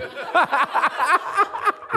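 high-pitched giggling laugh; amused high laughter; high giggle. Real, not acted. Laughing at own story.

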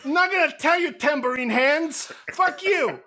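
high, loud catty refusal; bullied girl yelling back at slapper; indignant shrieking. Acted. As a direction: shriek your defiance, then give in. Slapped character refusing, then cracking.

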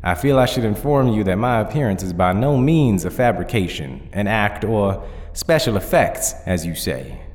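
lightly voiced dignified zombie cowboy; formal Southern cowboy. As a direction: explain with courteous Southern formality. Nail answers the judge formally.

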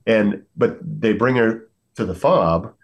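ordinary talk, nothing marked

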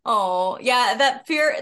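ordinary delivery

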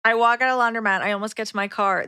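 ordinary delivery

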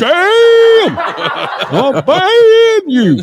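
high, loud, slow mock menace; hammed-up threat, dragged out. Host jokingly threatening to ban listener, hammed-up.

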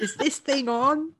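put-on high, drawn-out old fella voice. Joking about an ageing rider, old fella voice. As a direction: play a confused old man, slow and high. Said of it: "you poor old fella".

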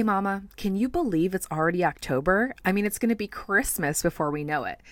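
ordinary delivery

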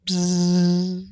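high buzzing mosquito imitation. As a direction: buzz like a mosquito passing. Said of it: "I heard in my headphones".

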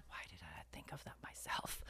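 hushed inner-thought whisper. Retold or imitated. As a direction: whisper it to yourself. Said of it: "he thought".

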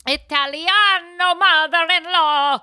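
put-on Italian accent.

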